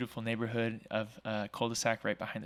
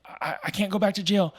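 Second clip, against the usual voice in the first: put-on much higher, louder panicked drunk; drunk man panicking; 9 semitones higher, 8 dB louder than usual. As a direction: imitate the scared drunk pleading. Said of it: "and he's like".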